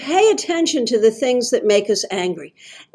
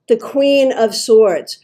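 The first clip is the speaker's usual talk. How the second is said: normal talking voice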